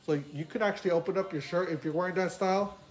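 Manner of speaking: muffled behind latex mask; speaking inside rubber mask; through a full face mask. Real, not acted. Seen 3: Speaker wears overhead latex mask covering whole face throughout.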